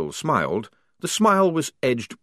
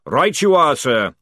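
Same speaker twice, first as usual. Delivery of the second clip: loud, pitched-up sergeant's reply; brisk military acknowledgement; 5 semitones higher, 7 dB louder than usual. Acted. Sergeant answering his lieutenant's order, loud and military. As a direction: snap back an acknowledgement.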